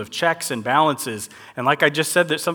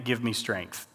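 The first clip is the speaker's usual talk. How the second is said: ordinary talk, nothing marked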